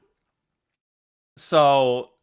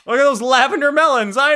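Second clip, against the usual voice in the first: excited joke; 9 semitones higher, 5 dB louder, faster than usual. Real, not acted. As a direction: Look at those lavender melons, high and loud with a falling end on melons. Joking about own drawing.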